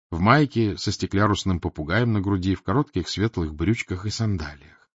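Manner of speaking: plain reading voice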